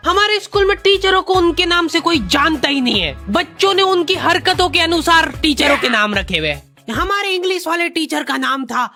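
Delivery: ordinary delivery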